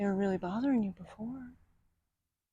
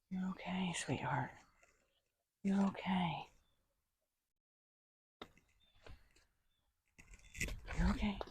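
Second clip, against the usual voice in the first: soft whispered reassurance; whispered comfort to a nervous horse; soothing whisper; 9 dB quieter than usual. Real, not acted. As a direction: hush her gently, close to her ear.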